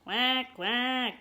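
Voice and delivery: storybook ducks calling back; ducks quacking. A narrator's reading. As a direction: quack twice up high like a duck. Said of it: "said the ducks".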